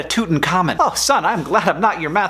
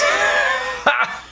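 high-pitched burst of laughter; laughing at own joke. Acted. Dad laughing at his Russian doll joke, high laughter. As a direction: crack up at your own pun.

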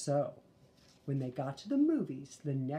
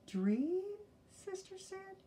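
put-on little girl voice; 3 dB quieter, slower than usual. Sister puzzled.

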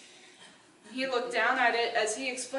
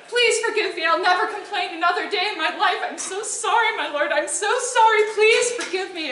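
high, loud, rushed begging; pleading cry; 7 semitones higher, 6 dB louder than usual. Acted. Terrified woodcutter begging the god for mercy, high plea. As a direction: grovel desperately, pitch up. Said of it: "he cried".

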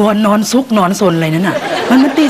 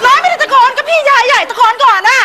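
shouting. Acted. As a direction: shout a denial. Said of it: "เนี่ยตะโกนอยู่นี่ไง". (ตะโกนเถียงเสียงสูง)

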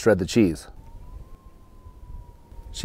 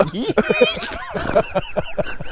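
high burst of laughter; high giggle; 13 semitones higher than usual. Real, not acted. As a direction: giggle uncontrollably. Laughing fit.

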